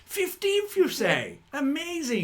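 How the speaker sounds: very high, loud amazed exclamation; impressed walrus. Acted. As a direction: gasp at the title in wonder.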